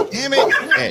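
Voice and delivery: high, loud squeal of annoyance; squeaky cartoon dog cursing; exasperated dog. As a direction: yelp it in mock frustration.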